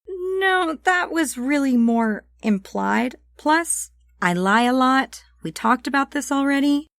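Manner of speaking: offhand Sam. Acted. Sam waves off the murder accusation, breezy. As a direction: brush the accusation off.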